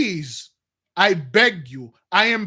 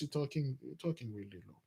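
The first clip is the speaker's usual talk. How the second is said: dropped to a much softer, lower murmur; softer and lower, keeping it discreet in the store; 7 semitones lower, 16 dB quieter than usual. Shown as a demonstration. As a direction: say it under your breath, much lower and softer. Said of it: "you low voice".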